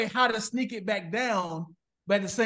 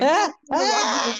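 loud protest; 7 semitones higher, 6 dB louder, slower than usual. Retold or imitated. Woman protests, retold.

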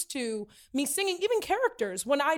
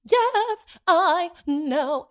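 smug bad singer; 5 semitones higher, 7 dB louder, much slower than usual. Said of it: "would be like".

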